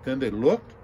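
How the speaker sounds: ordinary delivery, nothing marked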